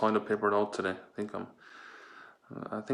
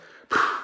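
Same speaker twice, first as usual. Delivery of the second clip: loud breathy sigh of pleasure; blissful sigh; 8 dB louder than usual. Pretend. Blissful sigh over toilet paper.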